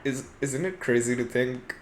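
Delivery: ordinary talk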